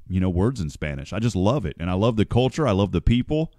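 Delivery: ordinary talk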